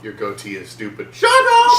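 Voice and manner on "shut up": high, loud outraged shriek; mock furious yell. Villain answering a goatee insult, furious yell. Acted quarrel over goatee.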